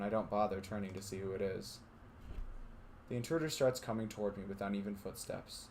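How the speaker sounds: steady narrator reading a visual novel; story narration. A narrator's reading. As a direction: read it like a storyteller.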